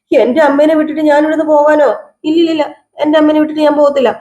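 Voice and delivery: high-pitched protest, finishing quickly; acted indignant refusal. Son refusing to leave his sick mother, high acted protest.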